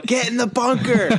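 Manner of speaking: high mock yell at a ball. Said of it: "they yelled".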